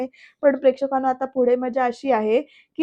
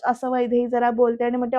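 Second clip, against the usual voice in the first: ordinary delivery, nothing marked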